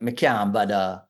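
patois statement, flat and plain; Jamaican patois. Shown as a demonstration. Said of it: "We would have said".

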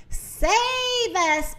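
high, drawn-out begging wail; pleading. Acted. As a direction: plead in a high voice, mildly acted. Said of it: "begged the sheep".

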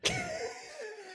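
a sudden high burst of laughter. Real, not acted. Laughing at Lord title.

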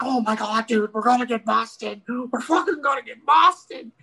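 high-pitched mock alarm; panicking brother. Brother watching bales cross the street, high mock panic. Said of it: "my brother's like".

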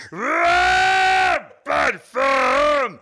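slow, high hammed-up monster shout; Cookie Monster voice. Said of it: "Sounded more like Cookie Monster".

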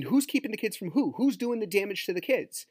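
high-pitched indignant questioning. Real, not acted. Rhetorical questions blaming the ex.

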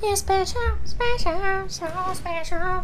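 very high, soft mocking singsong; mock cooing. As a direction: coo it in a tiny, high voice. Mockingly echoes the cheesy line.

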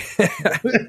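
wheezing giggle; breathless high laugh; high wheezy laugh. Real, not acted. Laughing at being sick.

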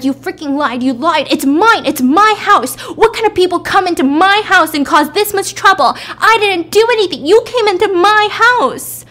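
high, loud, raging shriek; a hammed-up furious shriek as she strangles him; furious shout. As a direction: scream at him, rising in fury. Wife strangling husband, screaming.

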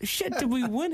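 high mock-shocked yelp. Retelling the call to his mate, a high shocked squeak. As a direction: play up the disbelief, way up high. Retold shocked reply on phone.

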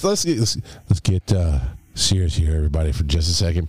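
low earnest pastor tone, quickly; serious youth group leader. Pretend. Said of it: "Put my youth pastor voice on".

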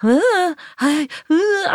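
high, wobbly, starstruck stammer; high-pitched wobbly. Retold or imitated. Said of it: "a high-pitched sort of wobbly".

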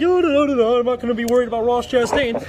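breezy, high, dismissive; carefree driver voice. Retold or imitated. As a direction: brush it off airily. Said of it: "he's rolling along here like".

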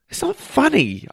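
high-pitched, indignant protest; scolding. A narrator's reading. Apprentice to laughing teacher after a feeble spell, scolding. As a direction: scold a friend for laughing at you. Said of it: "I scolded".

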